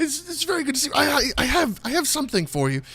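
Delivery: high eager halfling voice; [name]'s voice. Acted.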